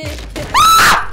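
sudden terrified scream; startled high scream. Real, not acted. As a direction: shriek in real fright. Scared scream in horror game.

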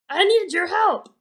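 ordinary delivery

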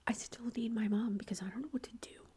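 normal talking voice

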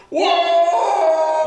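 sudden startled shout; startled whoa. Retold or imitated. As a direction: jump like a chair pulled away. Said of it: "You're just sitting there like".

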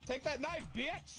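high, triumphant gloating; excited taunt. Real, not acted. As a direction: crow over the win. Winning knife fight taunt.

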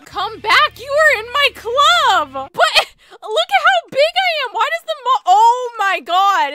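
high, loud protest; exasperated yelling. Pretend. Grown griffin to the mom who shut them in, loudly protesting. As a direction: call out in rising, overwrought complaint.

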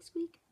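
high little squeaky mouse noise; mouse squeak. Shown as a demonstration. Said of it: "So it's like a little squeak".

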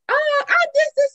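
stammering in a high voice; flustered high voice. Retold or imitated.

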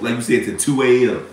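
ordinary talk

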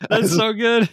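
high, loud, through laughter; laughing delight. Real, not acted. Co-host reacting to the hot dog punchline, laughing hard. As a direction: crack up while praising the joke.

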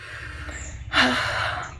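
long tired breath out; weary sigh. Real, not acted. Vlogger sighs to viewers about morning scolding, weary sigh. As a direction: let out a heavy, fed-up sigh. Mood off after parents' lecture.

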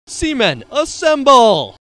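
rallying call. Acted. Leader rallying the team.

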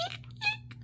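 tiny high eek; squeaky shoe. Shown as a demonstration. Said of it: "like a squeaky shoe".

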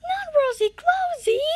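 dismayed cry. Real, not acted. Dismayed at the dare card.

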